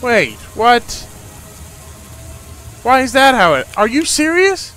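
can't believe the game just ended; indignant disbelief. Real, not acted. Player reacts to game over.

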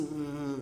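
low, quiet suspicious "hum"; mock detective's long pondering hum; "hum" de detetive. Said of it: "um detetive que toda vez". ("hum" baixinho, imitando detetive atento)

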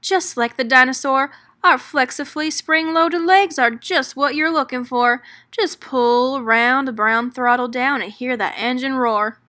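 whooping. Acted. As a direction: whoop like a rowdy salesman. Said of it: "whooped Hop".

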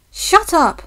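high-pitched, irritated outburst; stung, indignant retort; cross retort. A narrator's reading. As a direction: cut off the teasing crossly. Teased James snaps back.

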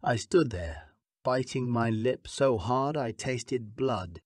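usual speaking voice

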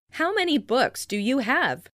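ordinary delivery

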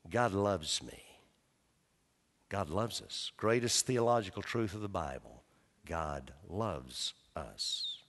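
almost whispering comfort to the hearer; hushed, intimate reassurance; tender assurance. Acted. Tender close of the sermon.

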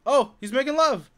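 loud, very high delighted cry; loud high-pitched surprised whoop; excited squeal. Real, not acted. Villager suddenly breeding.

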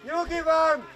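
steady the team from afar; shouted reassurance. Real, not acted. Coach tells players to keep calm, shouting.

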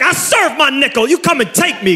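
high mock tough-guy voice; a lightly voiced ex-con; jailhouse bravado. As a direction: talk like an ex-con daring them.

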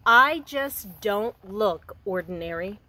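gentle storybook reading for a class; reading to children. Acted. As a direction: read it like a picture book to young kids. Teacher reads picture book aloud.

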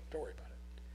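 soft unvoiced aside; whisper. Real, not acted. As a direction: whisper it to the listeners. Aside to listeners, hushed.

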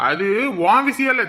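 ordinary delivery

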